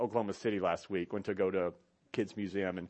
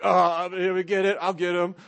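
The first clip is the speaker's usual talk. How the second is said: fake eager offer; 6 semitones higher, 6 dB louder than usual. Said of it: "You're so lying".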